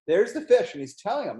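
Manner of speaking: plain reading voice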